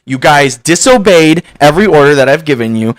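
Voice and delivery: loud, high dressing-down of a scoutmaster; a hint of the scoutmaster's rage, loud and steady; livid scolding. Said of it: "and just so livid".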